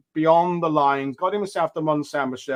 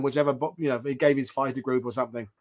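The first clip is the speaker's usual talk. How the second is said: usual speaking voice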